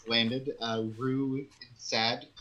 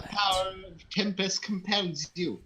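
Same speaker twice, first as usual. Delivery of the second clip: exorcist priest; 6 semitones higher, 5 dB louder than usual. Acted.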